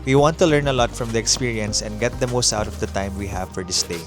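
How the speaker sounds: normal talking voice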